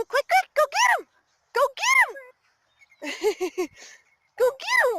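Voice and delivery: high, loud, excited urging. Real, not acted. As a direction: egg the pet on, all hyped up. Urging pet to chase.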